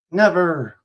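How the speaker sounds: loud, high, defiant cry; bold, headstrong refusal. A narrator's reading. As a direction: throw it back high and loud. Defiant friend refusing to calm down.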